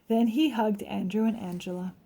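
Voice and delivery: ordinary delivery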